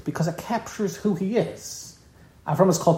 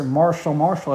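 name repeated briskly in disapproval, usual pitch; indignant quoting of a too-casual first name; disapproving mimicry. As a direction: throw the name back as overfamiliar. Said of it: "was disturbed by that".

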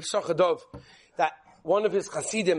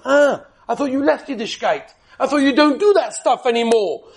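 higher, louder mocking jeer with a laugh; 5 semitones higher, 7 dB louder than usual. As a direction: taunt him like a jeering crowd. Wedding guests mock the groom.

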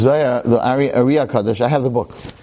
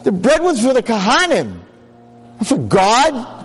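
furious scolding; 9 semitones higher than usual. Acted. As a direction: tear into him for his foolishness. Rabbi berates the naive man.

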